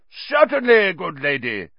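high, loud call dropping at the end; hearty cry. A narrator's reading. Portrait knight answers the professor, crying out. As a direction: cry out grandly. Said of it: "cried Sir Cadogan".

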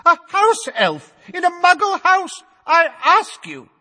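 pompous official jeering at the excuse; mocking minister. A narrator's reading. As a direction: ridicule it. Fudge mocking.

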